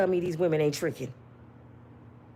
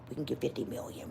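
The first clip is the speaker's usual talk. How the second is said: scheming whisper; 10 semitones lower, 15 dB quieter, faster than usual. Retold or imitated. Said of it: "let me tell you what happened".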